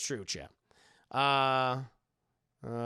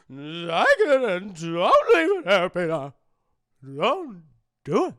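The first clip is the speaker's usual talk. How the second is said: Aunt May impression; 9 dB louder, slower than usual. Streamer quoting Aunt May to Peter, tearful pleading. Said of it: "Your Aunt May's close second".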